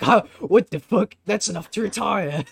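very loud, high-pitched disbelief; hammed-up stunned shopkeeper yelling; flabbergasted shout. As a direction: explode in shock at a fortune. Shopkeeper floored by second platinum.

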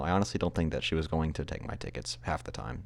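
ordinary delivery, nothing marked

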